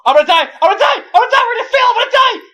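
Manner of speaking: high, loud panicked screaming; frantic high yells of doom; panicked shriek. Acted. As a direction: shriek like you're about to die. Panicked character yelling.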